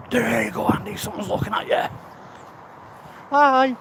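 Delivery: mocking inner critic. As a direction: tease himself like a snide inner voice. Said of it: "the internal critical self".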